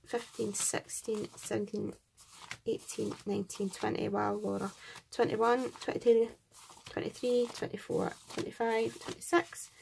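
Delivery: ordinary talk, nothing marked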